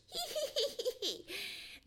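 high, giddy jay cackle; breathless cackle. A narrator's reading. Jay laughing at the scared fox, high cackle. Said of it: "Sammy Jay laughed until he lost his breath".

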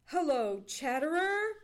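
loud, rising hail; cried greeting. Acted. Johnny greeting the red squirrel at the log, loud cry. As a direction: call out heartily to a friend. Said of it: "cried Johnny".

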